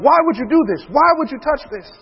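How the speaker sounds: high-pitched mock scolding; exasperated imitation of a scolding father; going off. Father going off on his young son, high mock scolding. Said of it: "I was going off on my son".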